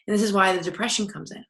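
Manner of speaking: ordinary talk, nothing marked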